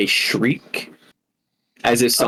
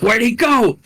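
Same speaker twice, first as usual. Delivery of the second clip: hunters searching for prey that has vanished; confused monster voice, pitched well up; baffled creature; 10 semitones higher than usual. Acted. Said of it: "suddenly they're like".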